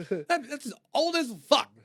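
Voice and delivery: high, slow, mocking disbelief; incredulous. Telling friend she's too old, high incredulous drawl. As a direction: stretch it out high in mock horror.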